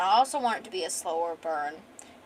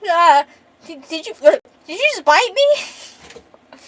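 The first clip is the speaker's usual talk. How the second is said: high, loud stammering disbelief; shocked disbelief; 9 semitones higher, 11 dB louder than usual. Acted. Man to the woman who just bit his neck, shocked disbelief. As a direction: sputter it out, voice jumping way up. Bitten mid-struggle, acted reaction.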